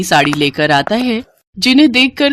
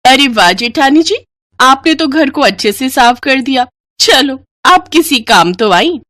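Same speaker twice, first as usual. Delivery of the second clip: higher, sneering taunt; sweet on top, scornful underneath; mocking young bride; 6 semitones higher, 3 dB louder than usual. Young bride taunts her elder sister-in-law, higher and mocking.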